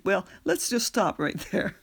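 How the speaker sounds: normal talking voice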